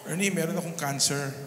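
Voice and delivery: low, subdued retelling of bad news; retold tearful call. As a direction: imitate a crying caller, understated and low. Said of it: "iyak nang iyak sa telepono". (mahinahong paggaya sa umiiyak)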